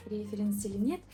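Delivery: ordinary delivery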